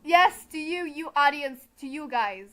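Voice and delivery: very loud, punchy call-out to viewers; loud, mock-stern announcement; emphatic address. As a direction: shout it straight at the audience. Emphatic warning aimed at the viewers.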